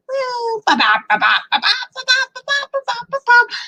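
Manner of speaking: high playful babbling at the dog. Real, not acted.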